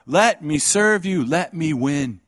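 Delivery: high, loud impassioned preaching; full-force preaching. Acted. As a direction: pour it out with fervor.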